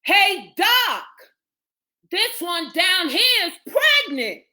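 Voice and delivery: high-pitched calling out; hollering. A narrator's reading. Ambulance attendant to Doc up the stairs, hollering. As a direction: holler up to someone on another floor. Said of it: "hollered up the stairs".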